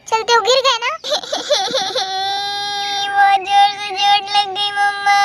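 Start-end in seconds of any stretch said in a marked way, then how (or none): 0.9-3.0 put-on wailing sobs of a hurt child; child sobbing. Child fell, crying. 3.1-5.2 put-on sing-song wailing complaint of a hurt kid; crying child.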